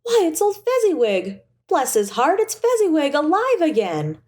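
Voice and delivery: high, thrilled cry of recognition; great excitement. A narrator's reading. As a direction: gasp it out, overjoyed to see him. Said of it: "Scrooge cried in great excitement".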